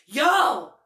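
"Yo" shouted, then a slow, loud scold; aggressive yell. Retold or imitated. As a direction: yell it like scolding a bad dog. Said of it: "I yelled at them like I would yell at, like, a bad dog".

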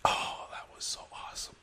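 hushed, whispery relish; savouring awe. Retold or imitated. Guest relishing the fight afterwards, whispered awe. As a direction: breathe it out as a blissful whisper.

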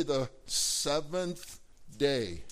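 slow, weighted stress on "seventh day"; emphatic repetition. Real, not acted. Preacher drilling a key term into the congregation, slow emphasis on "seventh day". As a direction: spell out "seventh day" slowly, word by word.

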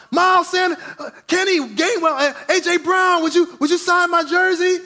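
high, loud calls for signatures; kid shouting. Retold or imitated. Kid calling to Eagles players, shouting. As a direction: yell each name across the field. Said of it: "He's shouting out".

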